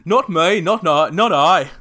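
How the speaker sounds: loud, high, hammed-up Australian accent; big exaggerated Aussie impression. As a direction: belt it out in a mock Australian accent. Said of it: "say it in her Australian accent".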